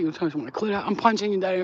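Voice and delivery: usual speaking voice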